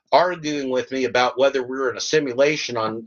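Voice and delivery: usual speaking voice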